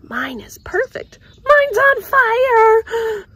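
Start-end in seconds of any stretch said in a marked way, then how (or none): 1.4-3.2 high, loud, drawn-out cry; alarmed cry. Acted. Girl seeing her marshmallow burn, high loud cry. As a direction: shriek in dismay.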